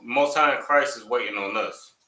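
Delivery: ordinary delivery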